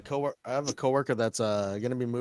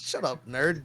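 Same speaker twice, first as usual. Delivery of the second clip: ordinary talk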